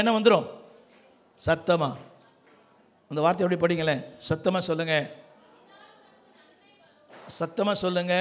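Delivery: ordinary talk, nothing marked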